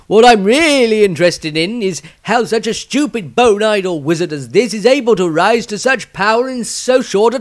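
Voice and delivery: scornful wizard. Acted. Viez scoffs at the bone-idle wizard, loud and sneering. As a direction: pour scorn on the fool, loudly.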